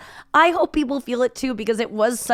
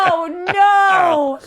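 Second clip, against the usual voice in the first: higher, drawn-out wail of dismay; real dismay; 7 semitones higher, 3 dB louder than usual. Real, not acted. Hearing a friend got kicked off and had to drive, horrified wail.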